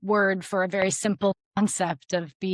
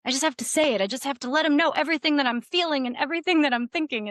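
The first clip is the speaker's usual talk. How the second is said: gushing overshare; 6 semitones higher, much faster than usual. Retold or imitated. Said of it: "we think that communication is just".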